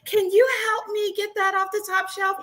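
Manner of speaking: high, sugary imitation of a flirt; mocking flirty voice. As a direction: play the sweet helpless woman asking. Mocking flirty women at store.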